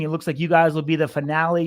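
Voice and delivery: ordinary talk, nothing marked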